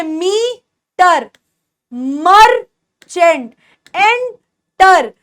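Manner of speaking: मीटर, मर्चेंट, एंटर very slowly, split at the syllables; each syllable held apart in मीटर, मर्चेंट, एंटर. Shown as a demonstration. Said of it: "अ की sound देता है".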